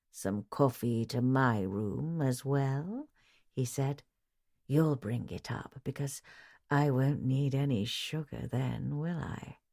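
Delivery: a lightly voiced leering man, slow; sly, suggestive leer at the maid. A narrator's reading. Lodger Mister Sloop leering at the maid Philida. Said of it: "His leer couldn't have been any more blatant".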